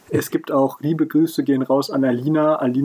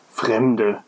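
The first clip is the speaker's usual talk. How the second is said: understated groan of a grumpy innkeeper; 3 semitones lower than usual. A narrator's reading. Innkeeper in a nativity play, groaning. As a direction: groan it mildly, as if fed up. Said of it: "stöhnte er".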